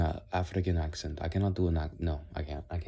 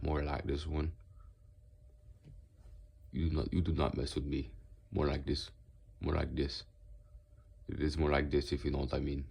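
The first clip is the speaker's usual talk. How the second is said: Southern US accent, a little lowered; deeper southern accent; 2 semitones lower, 4 dB quieter than usual. Shown as a demonstration. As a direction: attempt a low-key Southern accent. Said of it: "I was trying to do more southern".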